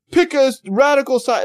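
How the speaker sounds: loud mock demand. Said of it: "People are like".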